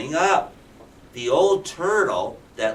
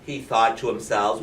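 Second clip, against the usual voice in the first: plain reading voice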